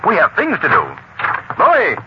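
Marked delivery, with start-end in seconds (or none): 1.6-2.0 high-pitched yelled summons; yelling. Acted. Cranston calls Louie to bring the packages in, yelling. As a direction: call out sharply for someone in another room. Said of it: "you don't have to yell".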